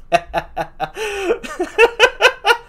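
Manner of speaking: high, drawn-out cackling laugh; amused laugh. Real, not acted. Storyteller tickled by the snow tale, high amused laugh. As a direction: laugh heartily in a high run.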